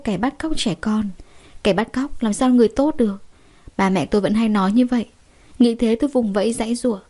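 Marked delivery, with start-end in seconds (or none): none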